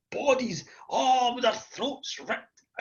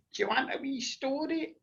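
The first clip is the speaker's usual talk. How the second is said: higher, softer granny offering a story; lightly voiced granny; 5 semitones higher, 7 dB quieter than usual. Granny asks the children at bedtime, lightly voiced coaxing. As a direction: coax gently, as a granny might. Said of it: "my granny said".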